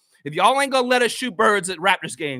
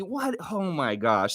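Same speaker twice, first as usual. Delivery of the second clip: ordinary delivery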